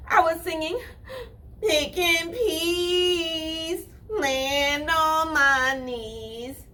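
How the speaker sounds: high, crying rabbit singing slowly; little squenchy voice. Acted. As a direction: confess in a squeezed little voice. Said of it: "in his little squenchy voice".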